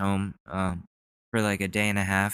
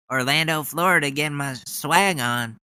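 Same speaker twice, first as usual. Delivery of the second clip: mock Ted Cruz; 5 semitones higher than usual. Mocking Cruz's speech line.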